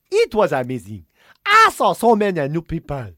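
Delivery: hammed-up Nigerian accent, deliberately paced; mock Nigerian accent. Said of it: "no Irish people go to Nigeria".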